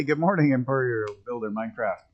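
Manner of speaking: normal talking voice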